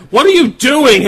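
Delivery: sharp loud yell; wife yelling. Retold or imitated. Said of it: "and then she's like".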